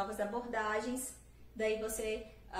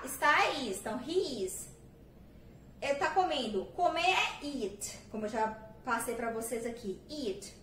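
ordinary talk

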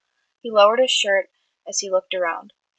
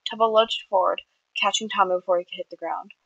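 ordinary delivery, nothing marked

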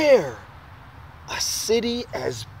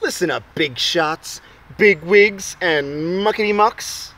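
bold announcement; 2 semitones higher, 6 dB louder than usual. Acted. Boy addresses the big shots.

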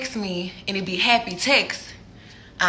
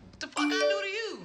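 much higher, breathy and soft; exasperated squeak; 9 semitones higher, 11 dB quieter than usual. Real, not acted. Asking the texter what she did, in a high soft squeak. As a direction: squeak it out in disbelief.

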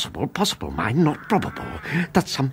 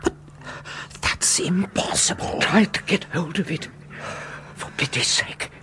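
urgent whisper; 6 semitones higher than usual. Acted. Urgent panic at graveside.

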